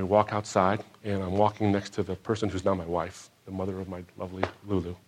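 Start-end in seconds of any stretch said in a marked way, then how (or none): none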